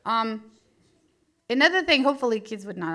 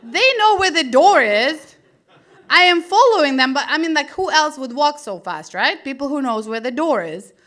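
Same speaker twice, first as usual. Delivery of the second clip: indignant thought; 4 semitones higher, 7 dB louder than usual. Retold or imitated. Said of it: "I was thinking".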